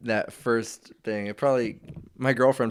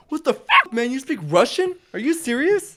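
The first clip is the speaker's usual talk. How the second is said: much higher-pitched, imitated stunned disbelief; mock incredulity; 11 semitones higher, 3 dB louder than usual. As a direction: act out being floored, pitch way up. Acting out stunned reaction to Russian.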